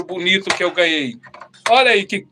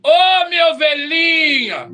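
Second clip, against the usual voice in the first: sweet pastor; 6 semitones higher, 3 dB louder, slower than usual. Retold or imitated. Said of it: "Pastor chegou lá em casa".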